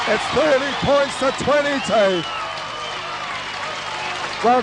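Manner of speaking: normal talking voice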